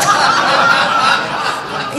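loud, high burst of real laughter. Real, not acted. Speaker recalling her gossiping at meetings, loud high laugh. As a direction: burst out laughing mid-sentence. Cracks up at her own judging.

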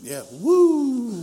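mock enthusiasm, clearly not excited; deadpan fake "Yeah, woo"; sarcastic cheer. Sarcastic reply about hometown.

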